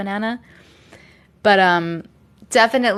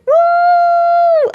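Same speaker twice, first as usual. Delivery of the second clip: high, loud cheer of joy; excited whoop; 8 dB louder than usual. Real, not acted. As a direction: let out a happy woo for the delivered merch. Merch delivered, cheering.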